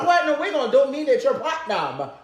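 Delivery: high-pitched playful clapback; sassy mock telling-off. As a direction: put them in their place, high and sassy.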